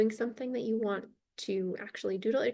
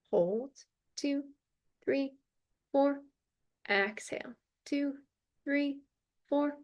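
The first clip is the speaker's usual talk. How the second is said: slow, even count with long gaps between beats; guided breathing count; 5 semitones higher, slower than usual. Shown as a demonstration. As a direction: count them through the breath, one measured word at a time. Leads a counted breathing exercise.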